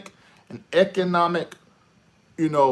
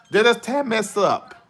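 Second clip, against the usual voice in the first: ordinary talk, nothing marked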